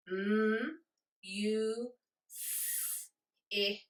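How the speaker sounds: music split into separate sounds, each held apart; sounded out phonemes. Shown as a demonstration. Teacher counting phonemes in music, sound by sound. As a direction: sound out "M, U, S, I, K" one phoneme at a time. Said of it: "Let's count the phonemes in the word music".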